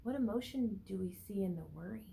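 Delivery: ordinary talk, nothing marked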